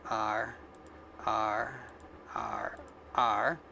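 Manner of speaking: low pirate R, drawn out. Shown as a demonstration. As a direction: say each R like a pirate. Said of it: "Yeah, I sound like a pirate".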